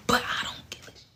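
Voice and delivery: whispered, voiceless swear; whispered aside. Real, not acted.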